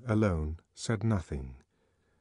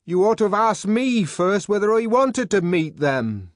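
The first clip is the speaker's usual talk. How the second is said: shouted, high indignant retort; loud retort; 12 semitones higher, 10 dB louder than usual. A narrator's reading. The savage refusing Bernard through the door, shouted retort. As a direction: throw it back at him.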